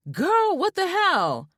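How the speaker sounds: high, slow, stunned outburst; shocked. A narrator's reading. Friend on video call stunned by the scream. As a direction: react like your friend just screamed.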